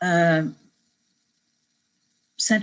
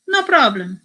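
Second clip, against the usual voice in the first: higher, louder mock Spanish-accented assurance; amateur Spanish accent; 6 semitones higher, 7 dB louder than usual. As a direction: breezily repeat it in a rough Spanish accent. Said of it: "repeat of those all too familiar words".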